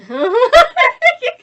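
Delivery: high-pitched burst of laughter; loud high laugh. Real, not acted. Reader breaking up during the rescue scene, loud laugh. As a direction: crack up loudly, drawn out.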